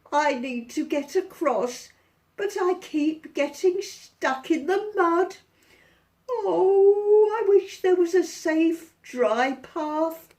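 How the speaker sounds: a howling, woeful dog; unhappy dog. Acted. Dog complaining to George, high and wailing. Dog pleads about the mud.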